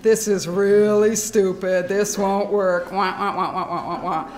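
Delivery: hammed-up complaining voice; mock whining. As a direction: whine like a doubter, half-laughing. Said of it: "it can seem really embarrassing".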